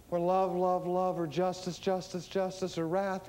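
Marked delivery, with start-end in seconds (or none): none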